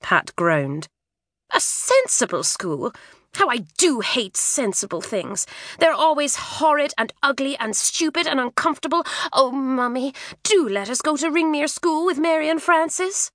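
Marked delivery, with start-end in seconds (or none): 1.5-13.3 higher, pleading schoolgirl; girl's pleading voice. A narrator's reading. Twin girl pleading with her mother, high and whiny. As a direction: beg Mummy like a cross twin.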